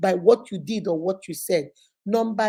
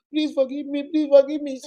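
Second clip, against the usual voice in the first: higher, pleading plea for forgiveness; mock begging; 5 semitones higher than usual. Imitating the person who begs a grudge-holder, higher and pleading. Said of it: "that person is running around begging you".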